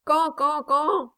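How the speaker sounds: high honking goose cry; goose cackle. A narrator's reading. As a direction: honk it out like a goose. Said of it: "se contentait de crier".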